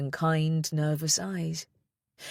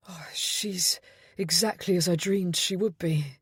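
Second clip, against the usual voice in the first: whispered, breathless wonder; a father's awed whisper to his baby; breathed; 2 semitones higher than usual. A narrator's reading. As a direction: breathe it out in awe. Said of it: "he breathed".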